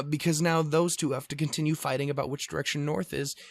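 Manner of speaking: normal talking voice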